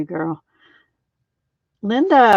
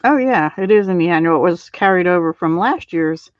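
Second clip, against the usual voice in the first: ordinary talk, nothing marked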